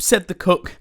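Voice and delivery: lively, loud read-aloud storytelling; animated picture-book reading; story narration. A narrator's reading. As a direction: read it big and bouncy for kids.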